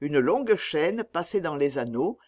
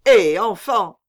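loud, raised hail; calling out; 4 semitones higher, 11 dB louder, slower than usual. A narrator's reading. Trader hailing his huddled slaves, loud call. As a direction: hail the whole group.

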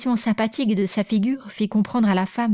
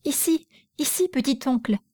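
put-on higher girl's voice calling out; little maid calling Pierre to follow; 5 semitones higher than usual. A narrator's reading. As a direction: call him over eagerly. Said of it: "s'écria la fillette".

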